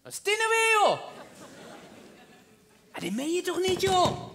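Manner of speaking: high, whining protest; exasperated disbelief. Acted. Man muttering at his bad luck, high exasperated protest. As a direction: complain upward in exasperated disbelief.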